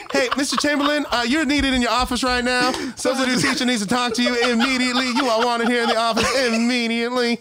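high-pitched secretary on the intercom; imitating intercom page. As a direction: page someone over the school speakers.

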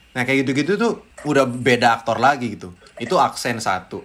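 ordinary delivery

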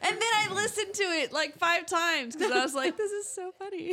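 laughing. Real, not acted. Laughing recalling the episode.

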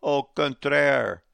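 emphatic French au contraire; loud au contraire. Shown as a demonstration. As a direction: boom out "au contraire". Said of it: "you listening to me in France".

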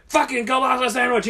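high, loud, hammed-up outrage; mock exasperated yell. Polish worker finding the same lunch, mock exasperated yell. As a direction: cry out like a fed-up worker.

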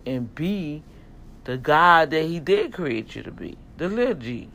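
loud, punchy street preaching; fired-up sermon to the men. Acted. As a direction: preach it hard to the brothers. Preaching again.

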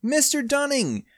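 high, loud shocked call; startled cry. A narrator's reading. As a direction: cry out in surprise. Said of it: "all cry at once".

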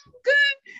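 very high little voice; high squeaky reply. Retold or imitated. Her answer to the deep-voiced star.

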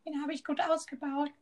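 imitating a young kid showing off; little kid voice. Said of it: "Auch in der Stimmlage ungefähr war das immer".

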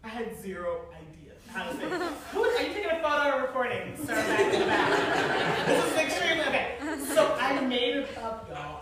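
ordinary talk

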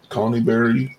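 ordinary delivery

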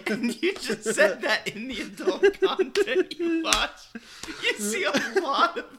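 high, loud laughing speech; laughing while talking. Real, not acted. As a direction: talk through laughter. Laughing while explaining joke.

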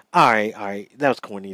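ordinary delivery

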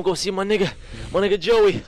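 imitating the buzzing father; dad voice. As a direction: hype it like the dad heading out. Dad voiced in retold story.

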